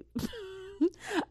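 a high breathy burst of laughter; high giggle. Real, not acted. Laughs retelling the girl's [name] line. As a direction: crack up at the memory.

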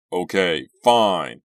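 loud, exasperated giving in; impatient. A narrator's reading. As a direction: give in loudly and impatiently. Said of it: "Doug impatiently pushed her hand away".

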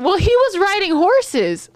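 high, slow, earnest mimicry; drawn-out high-pitched quote; imitated nurse practitioner. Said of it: "the nurse practitioner goes".

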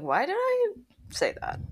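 high squeak, then a breathy whisper; mock horror at her own words; cringing at herself. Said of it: "I'll be like".

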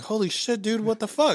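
high-pitched panicked disbelief, retold; freaking out. Customer telling friends about repeated charges, freaking out. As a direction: replay a freak-out, voice jumping up high. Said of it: "I'm fucking freaking the fuck out".

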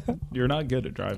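lightly voiced gentle old man; a hint of a kindly old man scolding; sweet old man voice. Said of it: "this sweet old man voice".

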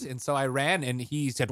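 ordinary talk

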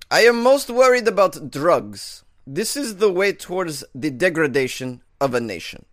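loud, slow mock Russian accent; hammy Russian leader declaring. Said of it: "He said".

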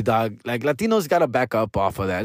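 ordinary talk

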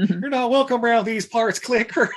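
high mock kid voice; hammed-up threat from a kid; hostile kid. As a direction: warn him off in a high, put-on voice. Retold kid warns off captain.

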